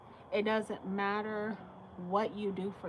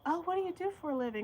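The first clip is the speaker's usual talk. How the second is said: high, fast prying mock voice; mock nosy stranger; 9 semitones higher, much faster than usual. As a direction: pitch it up and rush it, nosy and fake-friendly. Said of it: "and they say".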